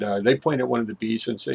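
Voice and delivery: ordinary delivery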